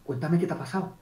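subdued reply. Acted. Coach replying to a delighted client, subdued. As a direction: answer softly, holding the energy down. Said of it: "acercar un poco tu emoción".

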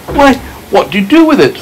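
put-on a child's curious question as James; James asking. A narrator's reading. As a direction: lift the voice up for the boy. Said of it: "James asking".

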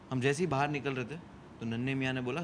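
normal talking voice

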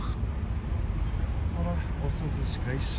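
drawn out, slow and disgusted; slow indignant verdict. Real, not acted. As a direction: let each word sink in with contempt. Outrage at the neglected church.

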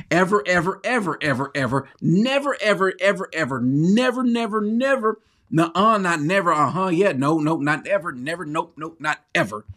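EVER and NEVER piled up high, in a relentless chain; hugely emphatic. Acted. Preacher showing how he says it, over-the-top emphatic denial with never and ever. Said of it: "That's how he says it".

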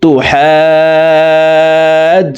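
তো হাজ with a very long a, caught on a held j; short তো, long sustained হাজ; four-beat stretched vowel. Shown as a demonstration. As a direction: stretch হাজ out slowly, then close on the j. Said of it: "চার আলিফ টেনে তাসদিদওয়ালা জিমকে ধরবো".

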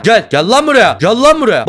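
loud, high repeated order; angry summons. Acted. Boss chasing a player who hangs back, angry shout. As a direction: yell at him to get over here.

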